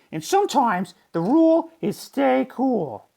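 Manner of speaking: high, kindly teacher voice; gentle teacher. A narrator's reading. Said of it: "said Missus Inky Dink".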